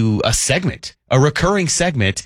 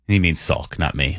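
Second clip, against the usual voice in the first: normal talking voice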